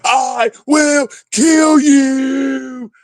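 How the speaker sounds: high, slow, screamed threat; hammed-up murderous shriek; mock death threat. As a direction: wail it like a madman. Mocking a raging friend.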